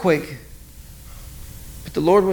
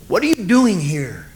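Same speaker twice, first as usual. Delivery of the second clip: voice of the Lord. Acted. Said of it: "and the voice says this".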